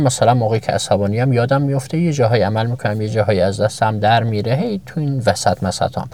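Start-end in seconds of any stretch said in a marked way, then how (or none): none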